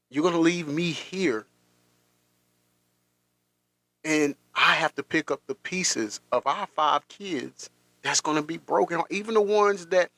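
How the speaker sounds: high, strained plea, pitch climbing; pleading, voice rising. Retold or imitated. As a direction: beg her to stop, close to tears. Pleading with dying partner, voice rising.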